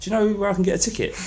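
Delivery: a high, quick quoted question; mild hint of a foreign fan pleading; quoted Spanish stranger. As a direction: lightly voice the stranger asking for a ticket. Spanish fan asking for ticket.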